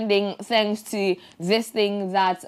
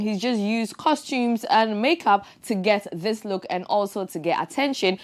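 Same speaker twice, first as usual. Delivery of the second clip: usual speaking voice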